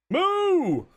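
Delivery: high, loud mooing; cow moo. Acted. Silly animal noise mid-chat.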